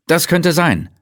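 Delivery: a high, eager interjection; the colleague butting into Moses' thoughts; Leitner cutting in. A narrator's reading. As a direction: jump in quickly. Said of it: "warf Leitner dazwischen".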